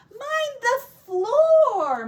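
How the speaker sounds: high, slow, loud cry of Mind the floor; cried out. Acted. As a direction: wail it out at the muddy piglets. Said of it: "Missus Pig cried".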